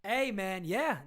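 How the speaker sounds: ordinary talk, nothing marked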